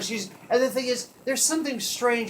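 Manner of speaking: ordinary delivery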